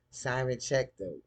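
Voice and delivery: usual speaking voice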